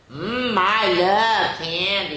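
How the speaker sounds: slow, savoring leprechaun, drawn out; sly leprechaun. Acted. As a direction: relish the candy slowly. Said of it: "he whispers".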